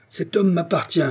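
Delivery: high-pitched, possessive challenge; fierce cry. A narrator's reading. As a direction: cry out to claim your quarry. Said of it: "cria-t-il". (cri de défi, voix haute)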